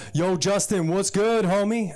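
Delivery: mock cool homie. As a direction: play a suburban kid trying to sound cool. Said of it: "Greg changed the way he talked".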